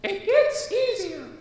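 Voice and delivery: very high, gentle fairy voice; kind fairy voice. Acted. Fairy comforting worried Maya, high and kind. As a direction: reassure her in a high fairy voice. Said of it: "said the fairy kindly".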